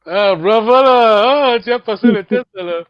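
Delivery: ah bravo lancé fort, très étiré; félicite l'élève avec joie; bravo enthousiaste. Real, not acted. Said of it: "félicite l'élève". (bravo joyeux, fort et étiré)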